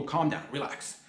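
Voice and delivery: ordinary delivery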